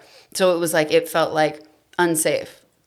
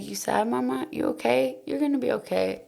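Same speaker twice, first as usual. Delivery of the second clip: put-on higher, softer little boy's voice, sweetly reassuring mom; toddler son comforting; 7 semitones higher, 6 dB quieter than usual. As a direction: voice a tiny kid patting his mother's face. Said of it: "he's like".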